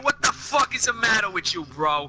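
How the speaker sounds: high-pitched hammed-up Brooklyn accent. Shown as a demonstration. Showing a friend what Brooklyn sounds like, hammed-up Brooklyn accent. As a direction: play up a confrontational Brooklynite's accent. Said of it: "You've never heard the Brooklyn accent?".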